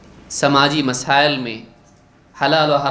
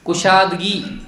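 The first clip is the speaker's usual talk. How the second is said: ordinary talk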